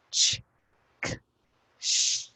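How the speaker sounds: "Ch, k, sh" as three separate voiceless sounds; phonics sounds. Shown as a demonstration. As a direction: sound out "Ch", "k", "sh" one by one, pausing between. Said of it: "Three sounds".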